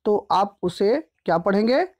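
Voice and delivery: ordinary talk, nothing marked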